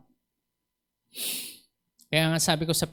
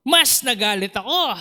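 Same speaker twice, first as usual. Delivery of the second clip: mock anger; 9 semitones higher, 4 dB louder than usual. Retelling his anger.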